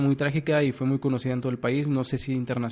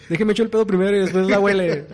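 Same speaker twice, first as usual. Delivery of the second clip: loud role voice; 6 semitones higher, 7 dB louder than usual. Pretend. Acted fart-job worker.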